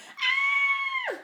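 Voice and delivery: high startled shriek; a sudden high scream of fright. Acted. Mum startled by Henry on the landing, shrieking. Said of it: "shrieked Mum".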